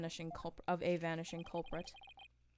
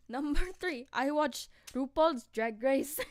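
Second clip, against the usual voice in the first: loud; 5 semitones higher, 7 dB louder, slower than usual. Pretend. Apollo voiced in a TikTok.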